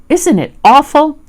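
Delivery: high, loud horrified question; squeaky storybook alarm; Piglet. A narrator's reading. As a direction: appeal to a friend in dread. Frightened Piglet clings to friend.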